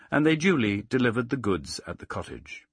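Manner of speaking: usual speaking voice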